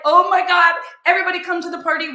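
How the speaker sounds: bubbling over with party plans; gushing excitement. Retold or imitated. She gushes about the party.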